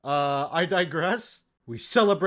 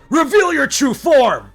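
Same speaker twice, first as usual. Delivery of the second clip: much louder, higher, slow bellow; shouted demand; 12 semitones higher, 12 dB louder than usual. Acted. Adventurer ordering the impostor to reveal itself, bellowing. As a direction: command the creature to show itself. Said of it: "As you shout your accusation".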